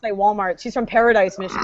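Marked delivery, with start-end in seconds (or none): 0.1-0.6 "Walmart" ending in a K; mispronounced Walmart. Retold or imitated. Quoting an aunt from Michigan, "Walmart" with a K. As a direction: say "Walmart" as Wal-mark. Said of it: "With a K".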